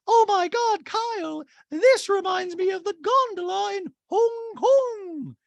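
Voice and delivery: high, slow gushing lady; Dorit impression. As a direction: gush it like Dorit. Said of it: "Dorit to Mauricio and Kyle".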